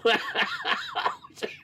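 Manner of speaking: shrill burst of laughter; high squealing laugh. Real, not acted.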